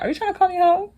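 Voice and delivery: high-pitched indignant question; mock offended. [name] challenging Sabrina over an insult, mock offended. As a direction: squeak it out, playfully affronted.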